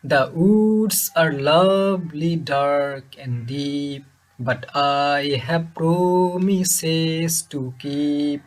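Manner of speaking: slow chanted verse, holding "woods", "dark", "deep", "keep", "miles", "sleep"; short first syllable, long second, as in "The woods" and "and miles"; iambic reading. Shown as a demonstration. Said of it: "তাহলে কি দাঁড়ালো". ("woods", "keep", "miles", "sleep" লম্বা টানে আবৃত্তি)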